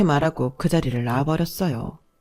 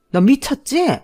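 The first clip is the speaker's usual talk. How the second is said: higher pitched furious accusation; mock-voiced angry elder; outraged scolding; 7 semitones higher, 3 dB louder than usual. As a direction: hurl it at her in disbelief. Mother-in-law confronting the narrator.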